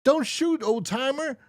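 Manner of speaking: high, loud squeaky plea; begging killer. Retold or imitated. The killer begging the old man, high and loud. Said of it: "killers beg".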